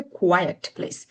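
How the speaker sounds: "কোয়াইট" as ku-wai-et before "place"; correct quiet. Shown as a demonstration. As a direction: give "কোয়াইট" two clear syllables. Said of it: "সেটা উচ্চারণ হচ্ছে".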